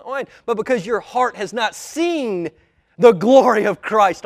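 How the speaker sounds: loud, high, ringing sermon delivery; full-force preaching. Acted. Preacher urging the congregation to see Christ's glory, preaching at full force. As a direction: preach it out, driving and emphatic.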